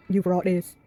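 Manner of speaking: put-on a light Scottish accent like Brave. [name] hands back the spatula, light Scottish accent. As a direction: give it a touch of Scottish lilt. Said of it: "why am I talking like that accent? Like Brave".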